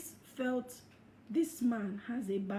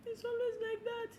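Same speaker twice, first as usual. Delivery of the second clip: mock whiny quote; 11 semitones higher, 3 dB quieter than usual. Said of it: "When you say".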